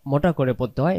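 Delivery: normal talking voice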